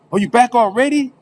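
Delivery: high, loud surprised question. Retold or imitated. Roommate seeing him back early from court, surprised. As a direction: act out a startled roommate.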